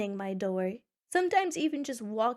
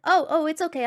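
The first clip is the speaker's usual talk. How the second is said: louder, higher mock-dad voice; imitated eager dad; 5 semitones higher, 7 dB louder than usual. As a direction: jump in loudly as an overconfident dad. Voices dad stepping in confidently.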